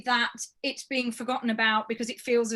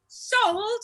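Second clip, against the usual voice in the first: loud, high auctioneer call; auctioneer's sold; 9 semitones higher, 6 dB louder than usual. Acted. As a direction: call it like a gavel falling.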